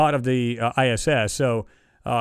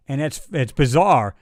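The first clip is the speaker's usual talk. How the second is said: usual speaking voice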